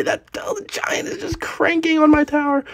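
breathless whisper of dread; panicked whisper. Real, not acted. Streamer watching the Giant smash his tower, tense whisper. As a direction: whisper it in alarm.

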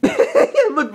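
high, giggling burst; laughing. Acted. Mowgli laughing at the knotted snake, giggling. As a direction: laugh as you point it out.

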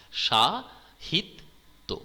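সা, হিত, তো spoken as separate beats; teacher tapping out the syllables সা, হিত, তো; syllables separated. Shown as a demonstration. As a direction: split সাহিত্য into সা, হিত, তো with pauses. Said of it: "তিনটে দল দেখতে পাচ্ছি".

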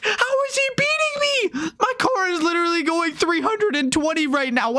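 high-pitched, indignant squeal; incredulous protest, pitch way up; outraged disbelief. Real, not acted. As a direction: cry out, baffled at being overtaken. Rival overtakes him in race.

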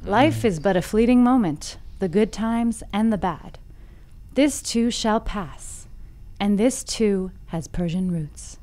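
softening, measured fable storytelling; story narration. A narrator's reading. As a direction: bring the fable to a quiet, deliberate close. Reads the fable aloud.

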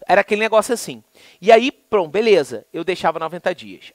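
ordinary talk, nothing marked

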